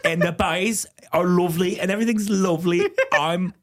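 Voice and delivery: put-on Tan France in a bright northern English accent; Tan France impression. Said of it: "Do you wanna hear my Tan France impression?".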